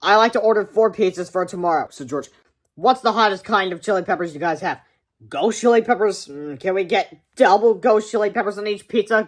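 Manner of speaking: sly prank order; kid on phone. Acted.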